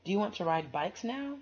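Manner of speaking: put-on bright, lively reading to children; storybook read-aloud. A narrator's reading. Reader sharing a picture book with kids, lively storybook voice. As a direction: read it like bedtime story time.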